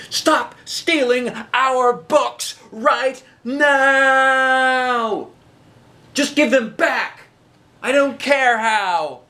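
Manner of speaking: high, slow, firm shout out the window. A narrator's reading. Eliza to the thief outside, high firm shout. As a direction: call out firmly into the night. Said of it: "shouted to the thing outside".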